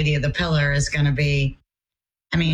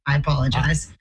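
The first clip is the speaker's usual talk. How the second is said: usual speaking voice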